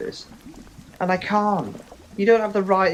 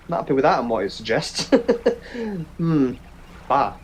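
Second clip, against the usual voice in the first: ordinary delivery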